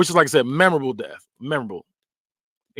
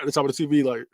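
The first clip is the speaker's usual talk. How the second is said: normal talking voice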